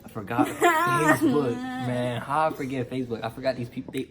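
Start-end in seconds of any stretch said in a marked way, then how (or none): 0.0-1.5 high, slow, loud wail of laughing regret; laugh-cry at his own mistake. Real, not acted. Teen laughing at himself for forgetting the Facebook block, in a high laugh-cry.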